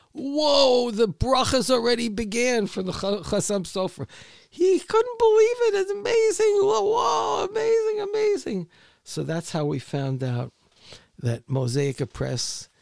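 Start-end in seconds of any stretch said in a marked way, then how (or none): 0.1-4.1 high, loud awestruck whoa, easing off toward the end; imitated amazed disbelief. Driver reacting to the author's email news, in loud amazed disbelief. 4.5-8.7 very high, loud excited shouting; imitated amazed disbelief. Retelling the driver's reaction to the news, shouting in amazement.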